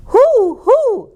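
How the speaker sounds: two high, hollow hoots; owl hoot. A narrator's reading. Owl flying over the stable, high hoots. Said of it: "hooted Owl".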